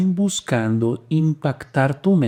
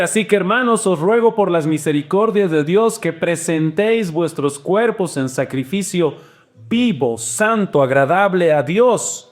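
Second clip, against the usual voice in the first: scripture reading; 4 semitones higher than usual. Acted. Reads scripture aloud.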